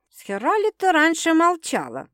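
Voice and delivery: pointed, high-pitched question; insinuating. A narrator's reading. Sister asking why she kept silent, insinuating. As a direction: probe with sly insistence. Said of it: "вкратчиво поинтересовалась сестра".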